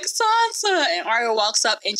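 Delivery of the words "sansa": high-pitched, drawn-out mock plea of a name; mock desperate call. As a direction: cry out her name in a comic high voice. Retold last desperate call to Sansa.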